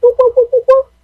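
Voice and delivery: high excited whoop whoop; party whoops pitched high; whooping cheer. Real, not acted. Cheering her girlfriend on.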